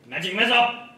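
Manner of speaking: loud spell call; shouted spell. Acted.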